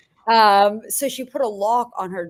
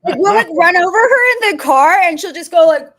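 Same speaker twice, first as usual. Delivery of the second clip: loud, high, laughing through the words; 10 semitones higher, 10 dB louder than usual. Real, not acted. Telling the podcast hosts about her grandma nightmares, laughing loudly. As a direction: crack up while telling it.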